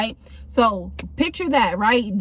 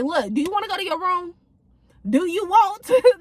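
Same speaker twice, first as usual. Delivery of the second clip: put-on higher, clipped warning to a misbehaving kid; hammed-up strict staff voice, higher; stern mock threat; 8 semitones higher than usual. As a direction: lay down the law, half joking. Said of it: "Nah, nah, I don't even be treating my kids".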